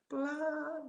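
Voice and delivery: drawn-out, soft and high; pelan. Retold or imitated. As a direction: stretch it out gently, like a slow lowering. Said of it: "saya diturunkan pelan". (pelan, dipanjangkan dengan lembut)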